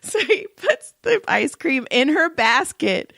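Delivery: ordinary delivery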